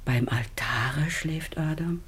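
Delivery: slow, breathless whisper; the dread dawning as she whispers; hushed, slow and afraid; fearful whisper. Acted. Mother senses the terrible truth.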